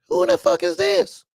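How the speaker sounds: very high, loud, baffled; shocked high squeal. Retold or imitated. Boss stunned by an unknown woman calling, high shocked squeal. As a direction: squeak out the question in disbelief.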